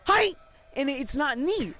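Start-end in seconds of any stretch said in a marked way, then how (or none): 0.0-0.3 loud, high yelp from the back; screamed fake sneeze. Class clown breaks the auditorium's silence, screamed sneeze. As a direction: burst out with a screamed "Hi!". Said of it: "someone in the back scream".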